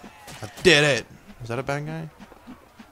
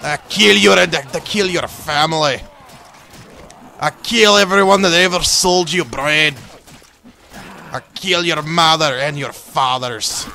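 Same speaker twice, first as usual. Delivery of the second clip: loud, higher, hammed-up barbarian threats; mock Viking threats; 5 semitones higher, 9 dB louder than usual. Gamer taunting his foe, loud hammed-up Viking threats. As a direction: bellow every threat like a berserker.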